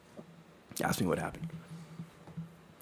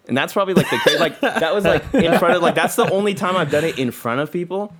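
higher, louder, laughing through it; laughing as he confesses to friends, higher and louder; 7 semitones higher, 5 dB louder than usual. Real, not acted. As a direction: giggle while admitting it. Laughs recalling risky story.

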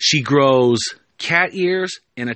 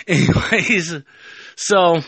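usual speaking voice

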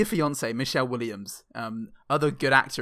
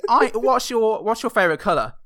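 higher, louder schoolkid voice; playing a pupil; child questioner; 6 semitones higher, 5 dB louder than usual. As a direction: ask haltingly like an eager kid. Acted child interviewing Riz.

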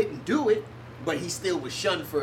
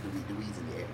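whispering; 11 semitones lower, 13 dB quieter, much faster than usual. Real, not acted. Remark under the breath.

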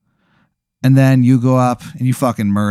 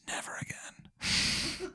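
slow breathy whisper, barely voiced; just mouth it, no voice; whispered solemn vow; mouthed words; 21 dB quieter, much slower than usual. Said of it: "see him mouth the words".

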